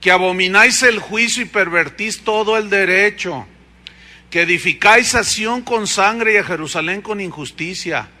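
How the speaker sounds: forceful scripture reading, loud and ringing; preaching. Acted. Preacher thundering scripture at the congregation, loud. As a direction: proclaim the verses to the congregation.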